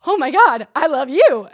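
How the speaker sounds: high, loud gushing fan squeal; loud starstruck gush; excited squeal. Retold or imitated. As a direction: shriek it like meeting your idol. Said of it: "And I was like".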